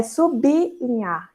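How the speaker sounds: "sublinhar" said sub-li-nhar, b apart from l; correct pronunciation. Shown as a demonstration. Teacher models the correct word, b split from l. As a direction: put a small i after the b in "sublinhar": su-bi-li-nhar. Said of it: "a pronúncia correta é".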